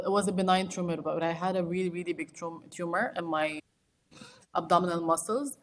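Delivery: ordinary delivery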